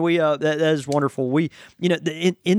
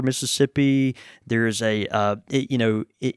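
ordinary talk, nothing marked

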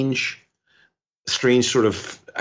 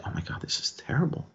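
said under the breath, much softer; hushed shock; 5 semitones higher, 10 dB quieter, faster than usual. Retold or imitated. Shocked visitor looking at the sculpture, hushed dismay. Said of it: "that tone, nothing really loud".

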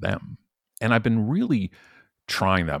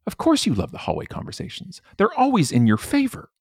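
exasperated retort; 14 semitones higher than usual. Retold or imitated. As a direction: call out the boss with disbelief. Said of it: "I'm like".

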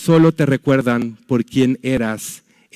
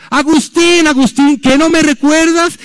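high, loud pleading call; pleading shout; 12 semitones higher, 4 dB louder than usual. Retold or imitated. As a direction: call out hurt that he forgot her, much higher. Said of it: "esta mujer le siguió gritando". (grito suplicante, agudo y fuerte)

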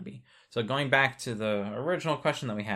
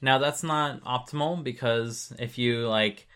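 ordinary talk

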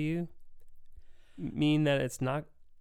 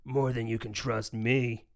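boss voice. Retold or imitated. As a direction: say it like a shady boss. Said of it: "his boss replies".

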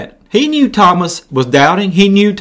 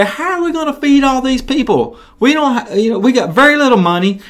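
panicky disciples; 9 semitones higher than usual. Acted. Disciples panic about feeding crowd.

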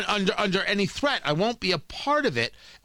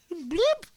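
laughing bloop sound; 12 semitones higher than usual. Real, not acted. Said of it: "laughs and sound".

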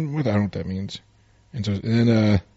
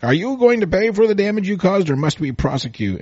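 higher, lightly voiced stuffy lawyer; pompous lawyer; 11 semitones higher than usual. Retelling the comic, the lawyer demands payment, put-on voice. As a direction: read the lawyer's threat in a put-on voice.

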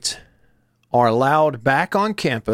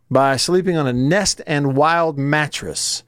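usual speaking voice

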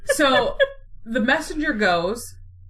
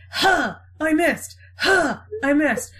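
comic grunt and miss; 5 semitones higher than usual. Acted. Antony botching suicide.

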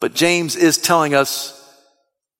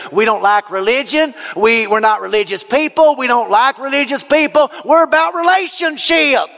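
country twang; 7 semitones higher than usual. Retold or imitated. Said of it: "why they say it in a country twang".

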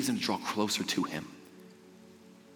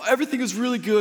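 much higher, forced brightness covering tears; fake cheerful; 9 semitones higher than usual. Crying teen tells his family all is fine, high forced cheer. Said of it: "and I'm like".

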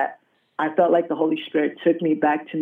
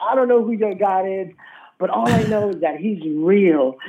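retold declaration to a congregation, pitched up; impassioned testimony; 4 semitones higher than usual. Newly healed youth on the mic at church, mildly impassioned. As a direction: proclaim it higher, with mild fervour.

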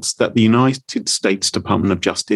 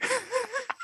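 sudden high, soft burst of laughter; a quick, surprised laugh that squeaks up high; real laugh; 14 dB quieter than usual. Real, not acted. Host caught off guard when a guest refuses Uber, laughing high and soft.

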